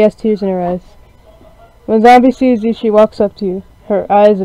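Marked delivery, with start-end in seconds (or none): none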